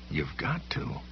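low-volume, urgent self-urging; harsh mutter. A narrator's reading. Frightened Sam urging himself on, harsh mutter. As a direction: mutter it harshly under the breath. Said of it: "he muttered harshly".

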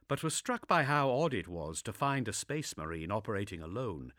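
plain reading voice

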